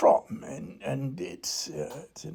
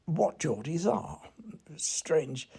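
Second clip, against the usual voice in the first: ordinary talk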